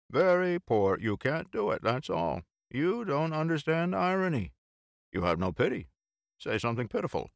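high-pitched, emphatic critic; lively jumps up in pitch; bantering friend, mock scolding. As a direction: scold a pal in mock disappointment. Bill scolds narrator over irony.

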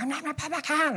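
a hint of the wife's indignant protest; imitating wife. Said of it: "She's like".

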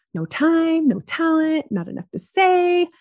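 high, sing-song list; reciting excuses. Retold or imitated. Podcaster listing old reasons not to launch, mock excuse-making.

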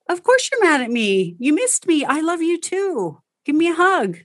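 mother welcoming her sulky son home; tender mom. Shown as a demonstration. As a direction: coax the toddler gently. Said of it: "So the goal is to be like".

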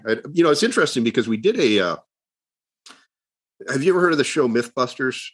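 ordinary delivery

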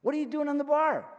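loud, high accusing cry; confronting shout. Acted. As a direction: call him out across the room.

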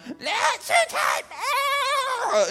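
high, drawn-out mock shriek; toddler tantrum. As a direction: imitate a small child screaming in protest. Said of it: "having a tantrum".

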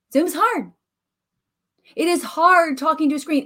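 a playful down-home twang; country accent. Shown as a demonstration. Teacher to her students, in a playful country accent. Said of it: "country accent".

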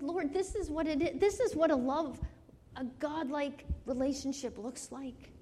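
high, moved cry to the Lord; tearful prayer retold. Speaker retelling a prayer alone in the car, emotional. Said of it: "I just cried in the car".

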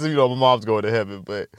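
normal talking voice

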